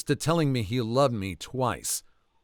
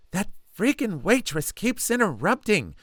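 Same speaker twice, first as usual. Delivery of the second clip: complaining; 7 semitones higher than usual. Retold or imitated. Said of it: "Susie keeps complaining".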